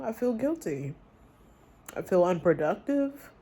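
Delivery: ordinary talk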